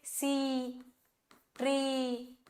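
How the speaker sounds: सी, ट्री with the 'ee' held long and steady; long vowel. Shown as a demonstration. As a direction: draw out one pure 'ee' in सी and ट्री. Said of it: "ये लंबा vowel है". (सी, ट्री में लंबा खिंचा 'ई')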